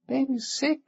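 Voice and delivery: put-on high, small child's voice; little boy voice. A narrator's reading. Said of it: "he said".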